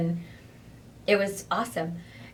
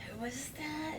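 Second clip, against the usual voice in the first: quiet; 6 semitones higher, 16 dB quieter than usual. Retold or imitated. Retold blank reaction, quiet.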